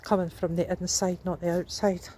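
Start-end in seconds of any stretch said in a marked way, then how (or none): none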